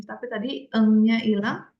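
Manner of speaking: usual speaking voice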